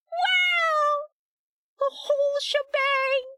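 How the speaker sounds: high, slow gleeful wow; excited squeal. Real, not acted. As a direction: squeal over the reveal. Unveiling the pouch.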